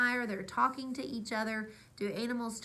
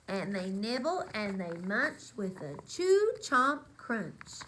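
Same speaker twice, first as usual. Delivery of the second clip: lively storybook read-aloud; reading to children; 4 dB louder, slower than usual. A narrator's reading. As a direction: read it like bedtime story time. Reads picture book aloud.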